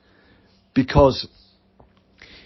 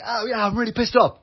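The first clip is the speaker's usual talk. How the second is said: open anger; 9 semitones higher than usual. Retold or imitated. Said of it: "much better at being like".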